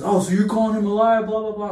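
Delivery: high, loud accusing coach voice; mock coach accusing. Coach accusing Willie of calling him a liar, loud mock scold. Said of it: "And he goes".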